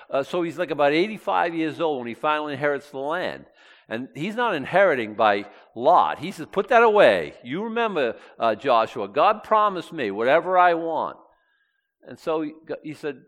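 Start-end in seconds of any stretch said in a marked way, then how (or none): 6.5-11.2 high, loud, emphatic claim; insistent demand. Acted. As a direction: press your claim with raised voice. Acts out Caleb claiming his promise.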